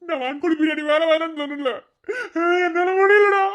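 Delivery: high, tearful wail; hammed-up weeping complaint; crying reproach. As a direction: scold your brother through sobs. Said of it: "எதுக்குடா அழுகுற".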